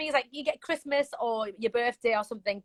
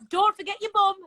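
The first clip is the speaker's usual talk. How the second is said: higher-pitched cute mum talk; northern mum; 8 semitones higher, 4 dB louder than usual. Acted. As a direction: playfully remind a little one, like a northern mum. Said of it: "cute things that I would naturally say ... as a northern mum".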